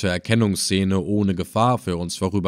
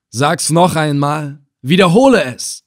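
raised, forceful order; commanding; 4 semitones higher, 5 dB louder than usual. A narrator's reading. Slave hunter ordering the old captive to repeat it, commanding. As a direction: demand it, growing louder and higher. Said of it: "gebot Ibn Assel dem Alten".

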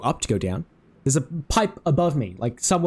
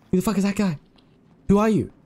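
ordinary talk, nothing marked

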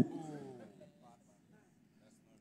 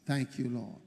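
leading prayer; 7 semitones lower, 7 dB quieter than usual. Real, not acted. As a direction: usher the congregation gently into prayer. Leads congregation into prayer.

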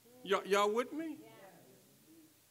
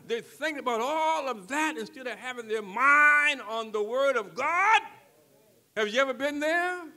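louder rising preacher's call; preaching build; 4 semitones higher, 11 dB louder than usual. Acted. Pastor to the congregation about dinner thoughts, building louder. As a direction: lift it up to the congregation.

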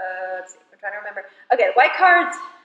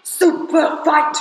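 announcer voice; 6 semitones higher, much slower than usual. Acted. Announcing the game, slow, high.

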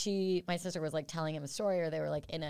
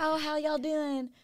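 put-on drawn-out Southern accent, pitched up; slow, higher Southern drawl greeting; Southern twang; 10 semitones higher, slower than usual. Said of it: "she's got a little Southern twang too".

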